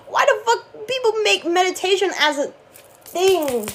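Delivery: high, loud exasperated rant; angry high outcry; furious. Real, not acted. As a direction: vent it, fully worked up. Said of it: "I don't know why I get so furious".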